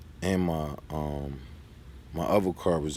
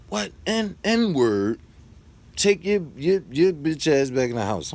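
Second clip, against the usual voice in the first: high, louder insult thrown at him; the neighbor shouting the slur at him, in a louder, higher retold yell; mock yell; 9 semitones higher, 4 dB louder, slower than usual. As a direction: bark it out like a furious neighbor.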